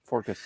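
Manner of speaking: "Forcus" with an r slipped into focus; mispronounced focus. Retold or imitated. Co-host echoing the host's slip, "Forcus", mispronounced with an r. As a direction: quietly mimic the slip, "Forcus". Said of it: "did I say focus wrong?".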